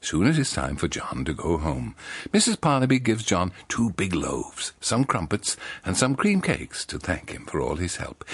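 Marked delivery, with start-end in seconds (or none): none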